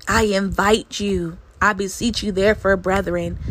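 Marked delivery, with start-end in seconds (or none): none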